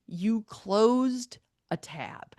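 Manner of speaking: incredulous echo. Retold or imitated. Repeating his excuse back to him, drawn-out incredulous echo. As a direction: repeat it back very slowly, acting dumbfounded.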